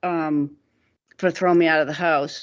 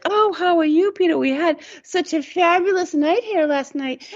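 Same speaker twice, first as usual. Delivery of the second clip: much higher, bright fake cheer; high chirpy mother; 12 semitones higher than usual. Hungover mother greets the neighbour, high gushing cheer. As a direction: gush like a hungover hostess covering up. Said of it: "she was like".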